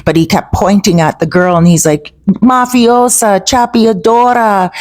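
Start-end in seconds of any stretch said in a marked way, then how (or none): 2.3-4.7 higher, drawn-out mock drunken accusation; imitated drunk accuser. Drunk tourist accuses a girl to the police, imitated drunken call. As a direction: imitate a drunk struggling to accuse someone in Spanish. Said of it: "because he was drunk, he really couldn't get his words out".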